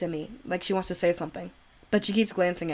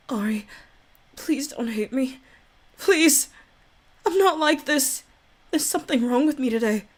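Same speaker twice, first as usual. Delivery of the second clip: shy Yuri voice; 6 semitones higher than usual. Acted. Yuri begs Ari not to hate her, timid and pleading. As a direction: plead with him, nervous and apologetic.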